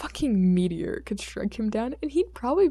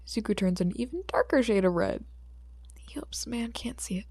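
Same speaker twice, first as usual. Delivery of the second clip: ordinary delivery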